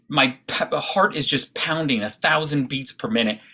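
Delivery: ordinary talk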